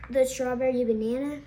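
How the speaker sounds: ordinary delivery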